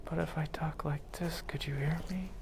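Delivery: barely audible whisper; too soft. Shown as a demonstration. Lecturer testing if students can hear him, whispering. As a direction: drop to a whisper that hardly carries. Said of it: "If I spoke too softly".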